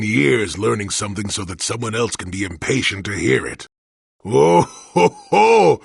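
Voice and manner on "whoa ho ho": high, loud mock-alarmed laugh; ho-ho at a hothead, half teasing; mock-startled chuckle. Old hermit mocking the visitor's fearsome manner with a high, loud chuckle.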